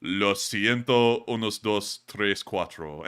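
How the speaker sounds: slow, deliberately bad gringo Spanish accent; mock clumsy Spanish. Streamer mocking politicians faking Spanish, slow badly accented Spanish. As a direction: sound out the Spanish words like a pandering tourist. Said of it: "and then goes".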